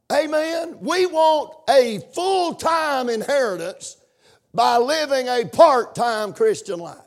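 rising, pressing sermon cadence; preaching at full force. Acted. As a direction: preach it, pushing harder each line.

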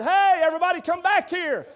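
loud high call to the family; preacher calling relatives down the hall. Retold or imitated. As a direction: shout them back into the room. Calling family back into room.

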